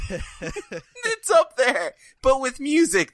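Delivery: words broken by laughter; laughing while talking. Real, not acted. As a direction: giggle through the words. Laughing through his explanation.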